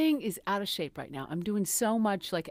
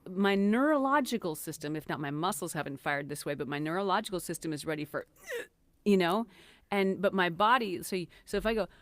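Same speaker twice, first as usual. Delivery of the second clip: ordinary talk, nothing marked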